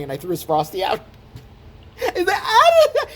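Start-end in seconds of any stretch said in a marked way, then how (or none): 2.4-3.1 very high, loud wail; mock crying wail. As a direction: wail like a man crying over his dessert. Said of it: "He cried over it almost".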